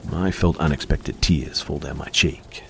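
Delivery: ordinary delivery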